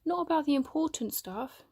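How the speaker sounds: put-on boy explaining what was missing, hurt; hurt reply. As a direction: press the point, hurt. Hurt friends argue.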